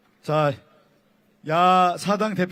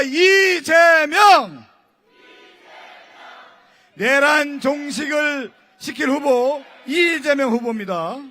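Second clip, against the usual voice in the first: shouting; 5 semitones higher, slower than usual. Real, not acted. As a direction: call the name out to the crowd. Rally MC chanting candidate's name.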